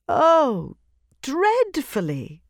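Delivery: high, slow, complaining princess; cartoon princess. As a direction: whine it like a pampered princess. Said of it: "said the princess".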